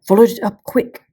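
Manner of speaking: excited shouted urging; urgent cheer. A narrator's reading. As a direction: yell encouragement at the fighter. Edmund cheering the fight.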